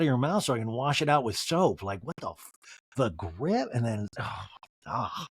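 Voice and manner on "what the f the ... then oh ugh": ordinary talk, nothing marked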